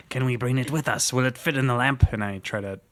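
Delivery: ordinary talk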